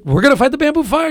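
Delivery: high, loud incredulous question; mock-astonished, voice jumping up high; retold disbelief. Retelling his shock at fighting a bamboo fire, loudly incredulous.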